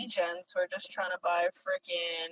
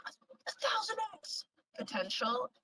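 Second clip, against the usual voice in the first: whispered aside. Pretend. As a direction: hush it like a secret. Mocking hype, whispered aside.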